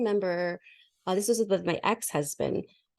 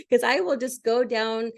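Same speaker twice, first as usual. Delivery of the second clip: ordinary talk, nothing marked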